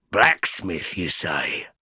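low, quiet convict mulling it; a stranger turning the word over slowly; gruff convict. A narrator's reading. Convict repeating Pip's word, low and quiet.

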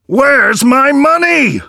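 demanding his coins back; outraged cry. A narrator's reading. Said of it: "cried the man".

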